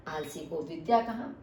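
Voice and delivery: ordinary talk, nothing marked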